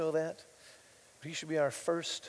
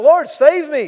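high, loud cry for help; cried out; 9 semitones higher, 18 dB louder, slower than usual. Acted. Peter sinking, crying to the Lord, loud plea. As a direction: shout it like a sinking man begging. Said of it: "Peter cried out".

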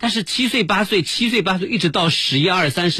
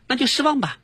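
put-on much higher, cheeky child's retort; defiant kid; 11 semitones higher than usual. Narrator imitates a boy answering his grandma. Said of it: "当当说".

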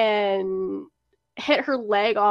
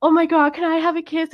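a hint of a gushing drunk girl, higher; drunk friend; 6 semitones higher than usual. Drunk friend gushing at Sarah, lightly voiced higher. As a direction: lightly play the tipsy friend begging for a kiss.

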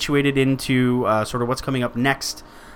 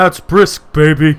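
commercial tagline impression; 9 dB louder, slower than usual. Finishing the friend's Brisk commercial, loud impression. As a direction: belt out the ad's punchline. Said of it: "that was the commercial".

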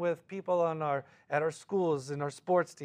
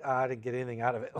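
fast, lower, offhand shrug; rushed mock listener; 4 semitones lower, much faster than usual. Congregant shrugging off the study, fast and offhand. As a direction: toss it off quickly like a bored listener. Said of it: "nobody's like".

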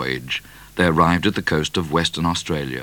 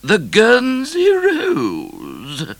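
high, loud, very drawn-out words; stern Queen; 5 dB louder, much slower than usual. A narrator's reading. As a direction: stretch out a stern royal prohibition. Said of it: "said the Queen sternly".

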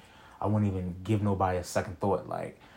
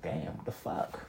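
muttered under breath; 3 semitones lower, 9 dB quieter, slower than usual. Retold or imitated. Frustrated muttering at work unfairness.

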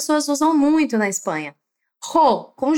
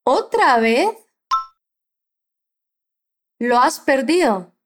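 slow model question; 2 semitones lower than usual. Shown as a demonstration. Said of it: "Fala depois de mim".

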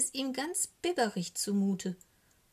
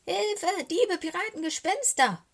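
high, loud cry for help; terrified yell, far above normal pitch; panicked scream; 11 semitones higher, 6 dB louder than usual. A narrator's reading. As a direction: shriek the alarm like a frightened raven. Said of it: "schreit der kleine Rabe".

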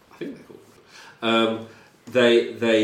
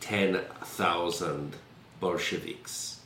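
put-on Russian-accented prisoner; 5 semitones higher than usual. Prisoner describes the count.

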